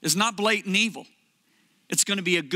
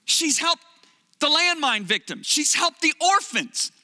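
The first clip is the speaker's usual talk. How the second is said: pleading; 8 semitones higher, slower than usual. Retold or imitated. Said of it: "And I said".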